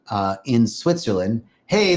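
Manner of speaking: ordinary talk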